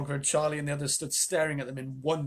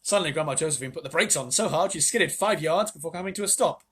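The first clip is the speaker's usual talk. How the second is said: ordinary delivery, nothing marked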